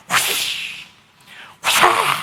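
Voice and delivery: unvoiced whooshing whip crack. Preacher mimics the whip for the congregation, breathy whoosh. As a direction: hiss out the lash of a whip. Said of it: "pinaramdam pa yung tunog ng latigo".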